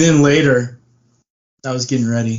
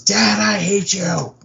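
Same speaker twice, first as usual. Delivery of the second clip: slow, raised-pitch mock declaration of hate; retold kid's outburst; 7 semitones higher, slower than usual. Dad retelling his kid's outburst, high mock drawl. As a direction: imitate a kid, high and drawn out. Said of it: "so she goes".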